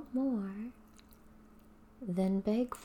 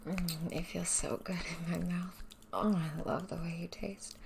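ordinary talk

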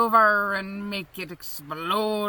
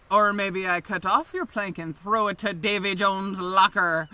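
normal talking voice

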